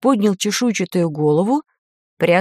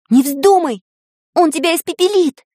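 higher-pitched, alarmed cry; frightened warning; 8 semitones higher, 3 dB louder than usual. A narrator's reading. Tanya stops Vanka from approaching the dragon, frightened. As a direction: blurt out an urgent, scared warning. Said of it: "испугалась Таня".

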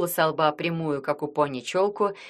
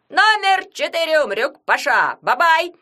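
sports commentator; 7 semitones higher, 7 dB louder than usual. Acted. Yagun commenting the match.

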